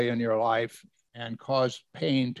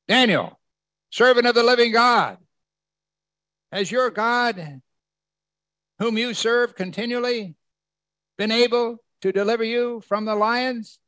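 acted anguished call, pitched way up; lamenting cry; 10 semitones higher, 3 dB louder than usual. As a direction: call out mournfully, as if tearful. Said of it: "He cried out in a lamenting voice".

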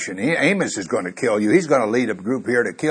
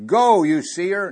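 slow, higher, louder dismissal; scornful order; 9 semitones higher, 5 dB louder, slower than usual. Retold or imitated. Priest driving the prophet away, slow and loud scorn. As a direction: drag out go with scorn for the seer. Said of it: "He says".

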